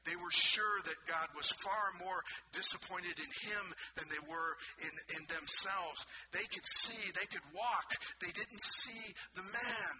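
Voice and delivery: loud, high-pitched pulpit cry; impassioned preaching. Acted. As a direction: build to a forceful, drawn-out close. Preacher at full force.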